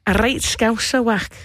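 quick burst of Liverpool Scouse accent. Shown as a demonstration. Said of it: "I'm originally from Liverpool".

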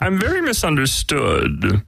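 high, slow cartoon alien; put-on high-pitched misunderstood alien; Marvin the Martian. Shown as a demonstration. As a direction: draw it out in a high little Martian voice. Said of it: "What about Marvin the Martian?".

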